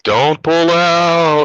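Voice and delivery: slow, drawn-out ghostly mom voice. Retold or imitated. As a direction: stretch it out like a haunting ghost. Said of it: "do the ghost voice".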